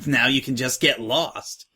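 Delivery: ordinary delivery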